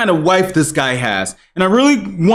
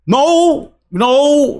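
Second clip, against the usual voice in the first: mock protest; 9 semitones higher than usual. Acts out the husband's reply.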